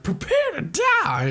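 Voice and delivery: very high, drawn-out mock battle cry; dramatic threat. Samus threatening Mother Brain, high hammy threat. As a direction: draw out a melodramatic threat, high. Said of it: "she's like".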